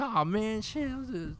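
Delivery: high, startled oh man; sudden realization. Retold or imitated. Corey realizes he's aiming at his friend, high and startled. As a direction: catch yourself, suddenly sheepish.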